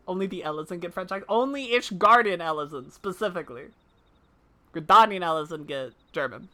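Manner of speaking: ordinary delivery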